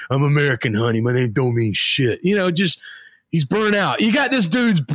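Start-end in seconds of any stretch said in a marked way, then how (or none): 0.1-2.2 lightly growled tough-guy line; low burnout hero, understated; a hint of a gruff action star; Bruce Willis. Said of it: "growls every time".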